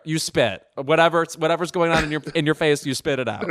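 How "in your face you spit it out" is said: usual speaking voice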